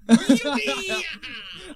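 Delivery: high, whooping laugh; gleeful laughter. Acted. Boy finding his friend alive, gleeful laughter.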